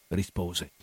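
ordinary delivery

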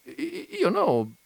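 high, halting stammer. Acted. The gentleman stammering at Veneranda, high and halting. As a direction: trip over the first word. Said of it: "balbettò il signore".

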